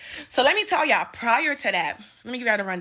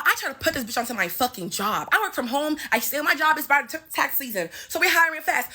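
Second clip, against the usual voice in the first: ordinary talk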